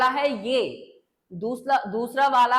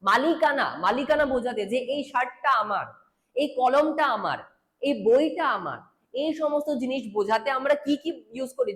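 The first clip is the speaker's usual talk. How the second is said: usual speaking voice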